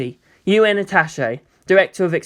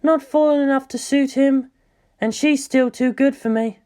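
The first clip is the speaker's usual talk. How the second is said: inner thought; 9 semitones higher than usual. Acted. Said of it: "Ritsuko thought".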